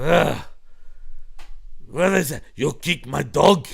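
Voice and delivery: slow, deliberate mock Indian accent; bad Indian accent, word by word; Vince's attempted Indian accent. Host imagining Vince attempting an Indian accent, badly faked. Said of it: "him trying to do an Indian accent".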